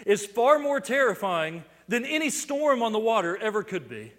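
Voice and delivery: loud, high, building sermon fervour; preaching at full force. Acted. Preacher to congregation, loud rising sermon fervour. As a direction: hammer home the awe of the great I Am. Preacher drives home the point.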